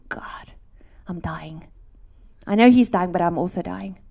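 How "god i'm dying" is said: whispered. Retold or imitated. Retold thought of dying.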